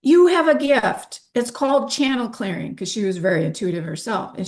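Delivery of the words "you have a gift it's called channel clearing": partner's voice pitched up, louder; high, loud imitation of the excited friend; excited quote. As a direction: mimic her eager insistence. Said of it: "she goes".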